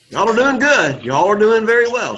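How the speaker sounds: bright, lifted praise for the class; enthusiastic praise. Real, not acted. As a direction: cheer them on, pitch up.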